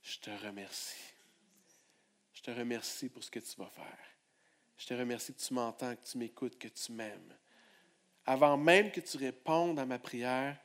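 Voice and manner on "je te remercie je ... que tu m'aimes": low, hushed thanks; whispered-soft prayer. Shown as a demonstration. Preacher models a prayer of faith for the church, hushed and tender. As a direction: pray it tenderly to God.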